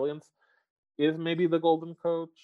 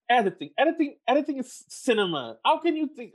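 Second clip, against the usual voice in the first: exasperated rant; 10 semitones higher, 3 dB louder than usual. Real, not acted. Ranting at the Academy.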